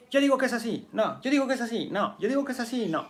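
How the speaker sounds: stubborn insistence. Acted. Teacher voicing a rigid person who won't budge, high insistent repeat. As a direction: hammer the same claim like an immovable know-it-all.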